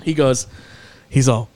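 normal talking voice

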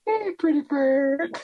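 high-pitched parrot imitation. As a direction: say it like a talking parrot. Imitating the movie's talking bird.

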